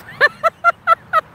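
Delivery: loud high cackle of a laugh; loud, high hysterical laughter. Shown as a demonstration. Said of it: "it's hysterical".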